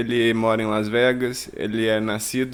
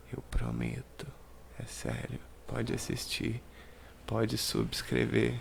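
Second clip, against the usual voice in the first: hushed joking aside; 9 dB quieter than usual. Acted. Joking plea for likes, aside.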